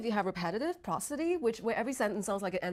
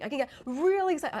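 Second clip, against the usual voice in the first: louder and higher, bursting; loud excited; 7 semitones higher, 6 dB louder than usual. Shown as a demonstration. Showing viewers a loud voice. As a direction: pump up the volume with excitement. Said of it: "the volume of which I can speak in".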